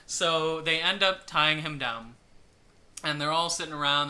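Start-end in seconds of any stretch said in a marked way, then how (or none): none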